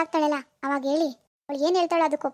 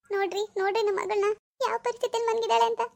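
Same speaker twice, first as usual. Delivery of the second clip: distressed mother; 5 semitones higher than usual. Acted. Mother laments to husband.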